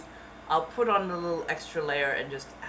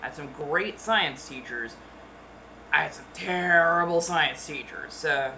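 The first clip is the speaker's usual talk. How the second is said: usual speaking voice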